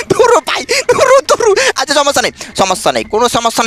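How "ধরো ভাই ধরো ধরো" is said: high-pitched frantic calling; panicked cry. Real, not acted. Startled gamer calling out to bro, high panicked cry.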